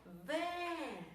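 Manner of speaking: Vê held long and high; stressed syllable drawn out. Shown as a demonstration. Teacher finding the tonic syllable, Vê stretched. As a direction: sing out a long closed Vê. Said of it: "Acabei de encontrar minha sílaba tônica".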